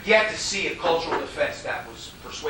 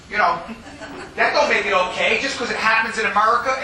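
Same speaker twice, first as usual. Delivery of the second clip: laughing scoff; 4 semitones higher, 5 dB louder than usual. Real, not acted. Scoffing at cultural excuse.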